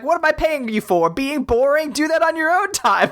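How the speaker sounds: a high-pitched mock boss yelling at the workers; hammed-up high voice of a bossy axe scolding the workers. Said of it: "he yells at all the other people".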